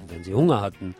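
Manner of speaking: ordinary talk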